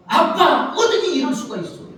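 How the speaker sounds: put-on high, loud child's cry of unfairness; wronged child. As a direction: whine to daddy like a wronged kid. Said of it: "우리 자녀들이 막 억울하면".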